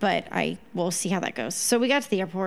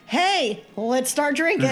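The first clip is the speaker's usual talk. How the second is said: high-pitched mock party call; rally your drinking buddies, playfully hammed-up; imitated rowdy greeting; 6 semitones higher, 4 dB louder, slower than usual. Retold rowdy greeting on plane.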